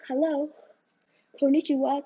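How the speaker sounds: ordinary delivery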